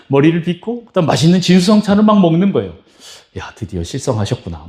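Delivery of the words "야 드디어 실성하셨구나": mock aside. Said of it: "뭐 이런 생각이 들 정도로".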